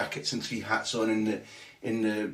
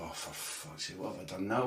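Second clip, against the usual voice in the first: muttered dread; 4 semitones lower, 9 dB quieter than usual. Retold or imitated. Dreading the Celtic manager's call.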